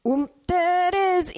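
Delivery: high, slow party-hype call; party shout. Pretend. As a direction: hype up the whole bar. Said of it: "it'll be like".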